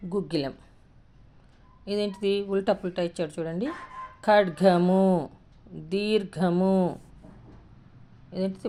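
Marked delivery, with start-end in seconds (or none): 4.3-7.0 ఖడ్గము and దీర్ఘము drawn out very slowly; slow pronunciation. Shown as a demonstration. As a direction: stretch each syllable of ఖడ్గము and దీర్ఘము. Teacher reads out practice words.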